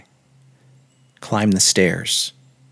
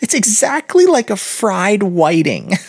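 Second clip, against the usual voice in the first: put-on giggling girl; 11 semitones higher, 3 dB louder than usual. A narrator's reading. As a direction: play a tittering girl, pitched way up. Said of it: "giggled the girl".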